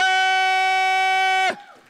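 loud high laugh. Real, not acted. Preacher laughing loudly after urging the back to clap. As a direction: burst out laughing.

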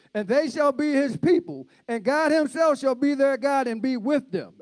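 high-pitched, ringing scripture proclamation; fervent preaching. Real, not acted. Preacher reads Revelation's promise to church, fervent preaching.

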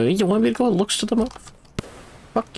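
fast squeaky mimic; high mocking voice. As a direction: mimic the chat in falsetto.

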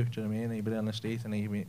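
ordinary talk, nothing marked

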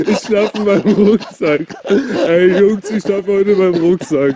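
laughing through the words; giggling helplessly while talking. Real, not acted. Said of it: "ich muss lachen".

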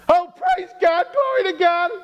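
high-pitched ecstatic wife voice; overjoyed praise. Acted. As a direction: cry out thanks to God, hammed-up. Wife's overjoyed cry at the milk.

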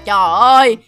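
normal talking voice

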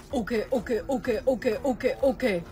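low, soft, repetitive self-talk; nervous muttering. Acted. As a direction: mutter it under your breath to steady yourself. Bracing to read the lyric.

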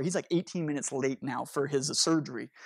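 ordinary talk, nothing marked

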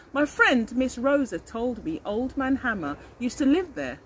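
ordinary delivery, nothing marked

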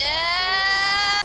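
long, high, loud cheer; real triumphant shout; excited yell. Real, not acted. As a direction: shout it out and hold it. Cheering a play in game.

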